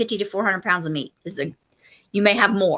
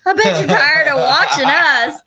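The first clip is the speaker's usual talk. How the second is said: laughing quote; 7 semitones higher, 4 dB louder than usual. Retold or imitated. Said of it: "I said".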